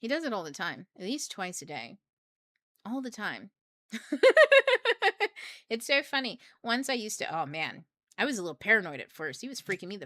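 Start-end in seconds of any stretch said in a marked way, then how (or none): 3.9-5.6 loud high burst of laughter; big laugh. Real, not acted. Laughing at how her husband always knows.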